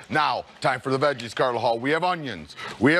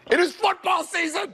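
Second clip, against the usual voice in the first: excited shout; 14 semitones higher than usual. Real, not acted. Host announces football excitedly.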